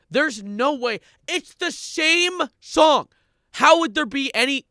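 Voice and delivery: very high, loud, slow disbelieving squeal; incredulous shriek. Real, not acted. Streamer shocked someone wrote an article, incredulous shriek. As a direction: screech out the disbelief.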